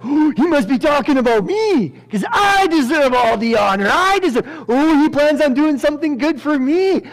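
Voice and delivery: high, loud, hammed-up excitement; gleeful self-importance. Haman thinking the king means him, mock gleeful. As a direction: gush as a conceited man sure of reward. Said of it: "In Haman's perspective".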